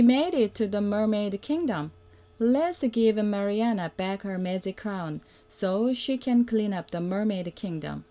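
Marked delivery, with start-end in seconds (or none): none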